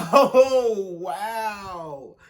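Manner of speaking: high laugh into a wow; shocked laugh. Real, not acted. Reacting to the explicit part, high laughing.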